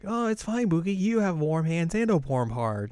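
high, cooing imitation; mock tender reassurance. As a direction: comfort a friend in a sweet high voice. Yui reassures Mugi.

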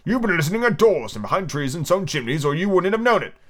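high-pitched indignant accusation; sudden passion. A narrator's reading. Humpty accuses Alice of eavesdropping, in sudden passion. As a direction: accuse in hammed-up storybook outrage. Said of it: "breaking into sudden passion".

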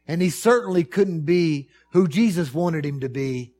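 high, loud, impassioned preaching; preaching at full force. Acted. Preacher describing Peter's failure, high and loud. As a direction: pour it out over the congregation.

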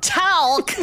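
put-on high, drawn-out southern accent. Player tries out the button's word in a southern accent. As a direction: say it as a questioning southern twang. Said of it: "In a southern accent".

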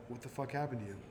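ordinary talk, nothing marked

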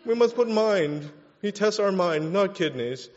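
high, fussy mock prude; mock the squeamish crowd, fussy; prissy mock voice. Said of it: "we're like".

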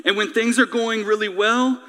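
low, measured closing prayer. Real, not acted. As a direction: pray quietly and earnestly for the congregation.

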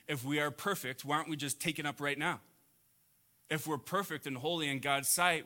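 usual speaking voice

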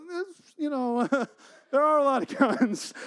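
breaks into high laughter mid-line; laughing. Real, not acted. Laughing while joking to viewers about Texas guns. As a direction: laughs through the words, pitch jumping up.

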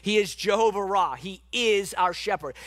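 ordinary talk, nothing marked

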